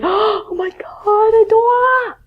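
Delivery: high squeaky mock panic; panicked learner. Teacher mocking a student's panic, high and squeaky. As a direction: play a freaked-out student whining. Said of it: "so please do not think".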